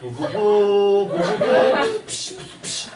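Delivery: hammed-up noises of waking up to fight; mock battle noises. Teacher acts out the boys charging the dog, playful noises. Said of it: "이래가지고".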